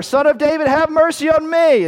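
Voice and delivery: high, loud, insistent plea; crying out. Acted. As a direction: shout all the harder for mercy. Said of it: "he cried out all the more".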